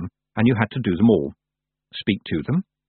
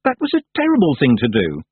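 stern; 5 semitones higher, 4 dB louder than usual. A narrator's reading. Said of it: "said Tiffany sternly".

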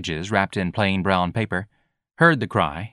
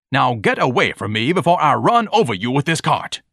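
raised, indignant warning; pompous Ignatius, threatening; 5 semitones higher, 4 dB louder than usual. A narrator's reading. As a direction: threaten him with haughty anger. Voicing Ignatius threatening the boy.

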